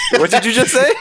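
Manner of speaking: high-pitched, incredulous question through laughter; cracking up in disbelief; laughing disbelief. Real, not acted. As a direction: laugh as you demand an explanation. Reacts to a friend's absurd pick.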